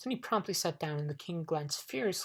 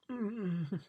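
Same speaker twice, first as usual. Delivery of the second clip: mumbling; 4 semitones higher than usual. Acted. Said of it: "mumbled the Earl".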